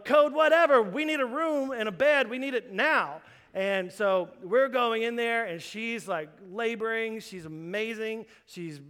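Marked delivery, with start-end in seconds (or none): 0.0-3.2 loud and higher, barking orders; hospital emergency alarm; urgent call. Retold or imitated. Nurses calling urgently for room.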